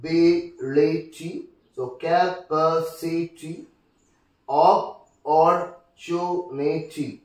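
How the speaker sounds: slow, syllable-by-syllable "ability", "capacity", "opportunity"; broken into syllables. Shown as a demonstration. Teacher counting syllables from the last, "ability", "capacity", "opportunity" slowly broken up. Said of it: "syllable on the third from the last".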